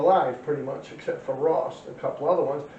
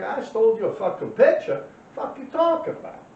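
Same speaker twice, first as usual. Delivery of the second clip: indignant retort; 6 semitones higher than usual. Retold or imitated. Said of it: "I'm like".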